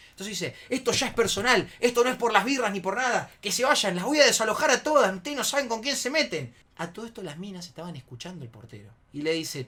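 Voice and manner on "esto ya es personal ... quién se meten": high, loud angry rant; retold shouted threats; furious threatening. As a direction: rage that it is personal now. Enraged friend threatens eviction.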